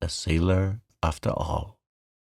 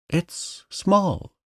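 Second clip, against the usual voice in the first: much higher excited cry; 11 semitones higher than usual. A narrator's reading. Piglet spotting the beetle, excited cry. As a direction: blurt out the discovery. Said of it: "cried Piglet".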